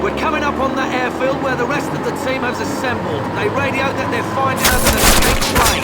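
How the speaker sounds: high, loud orders under pressure; urgent shouting. Acted. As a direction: hurry the squad to the plane. Soldier shouting during escape.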